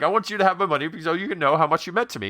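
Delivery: high and fast mock yell; mock screaming argument. Richard Lewis explaining the will in a fast, high mock yell. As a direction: rattle it off fast and high, pleading. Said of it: "they end up screaming back and forth".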